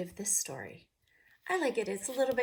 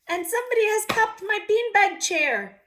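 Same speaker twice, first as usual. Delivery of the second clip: upset little person; 13 semitones higher, 11 dB louder than usual. Acted. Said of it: "maybe I should read it like".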